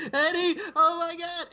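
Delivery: slow, very high mocking impression; mock whiny wail. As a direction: drag it out way up high, overdramatic. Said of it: "she opens up that voice".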